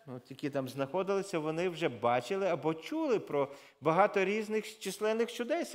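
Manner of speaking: normal talking voice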